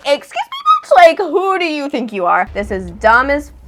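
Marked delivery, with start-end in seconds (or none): 0.1-2.5 high-pitched offended outburst, hammed up; indignant outrage. Girl confronting David who ignored her, hammed-up indignation. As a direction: act out affronted disbelief.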